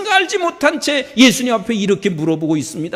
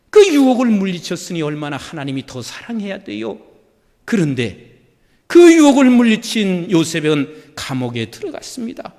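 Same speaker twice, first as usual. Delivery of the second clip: ordinary delivery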